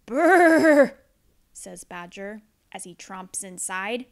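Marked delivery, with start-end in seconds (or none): 0.0-1.0 a big loud chattering brrr; loud shivering brrr. Acted. Badger stomping in from the cold, loud brrr.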